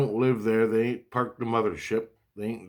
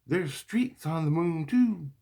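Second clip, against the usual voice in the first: much higher, drawn-out mock child voice; imitating kids; 9 semitones higher than usual. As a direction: chime in like a child, high and slow. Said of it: "my kids chimed in".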